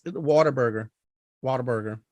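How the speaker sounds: Whataburger with a rounded "waw-ter" start; Philly pronunciation. Shown as a demonstration. Philly co-host trying the name, Philly pronunciation. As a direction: say Whataburger as "wawter-burger". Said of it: "that's being from Philly".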